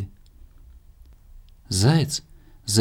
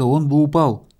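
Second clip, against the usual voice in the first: ordinary delivery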